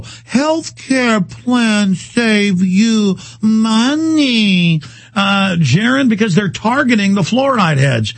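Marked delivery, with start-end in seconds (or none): 0.0-4.8 slow, high, drawn-out voice; patronizing mock TV voice, dragged out; mock slow talk. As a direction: talk down like to a small child, very slow. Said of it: "they now talk to you very slow".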